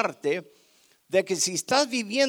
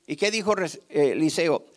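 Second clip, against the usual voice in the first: ordinary delivery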